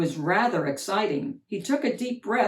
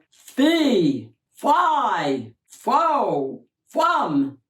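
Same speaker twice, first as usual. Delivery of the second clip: booming fee fi fo fum; 3 semitones higher, 6 dB louder, much slower than usual. A narrator's reading. Giant's footsteps approaching.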